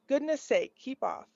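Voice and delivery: ordinary delivery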